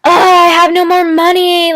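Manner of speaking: mock pleading stranger; imitating a beggar. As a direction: plead for gas station money, hammed-up. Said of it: "somebody's just like".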